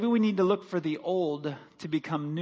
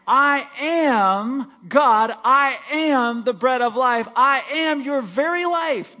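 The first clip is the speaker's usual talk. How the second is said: rising, louder and louder declarations of I am; preaching at full force; 4 semitones higher, 5 dB louder than usual. Acted. Pastor to congregation, swelling loud preached declaration. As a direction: build each I am higher until it rings.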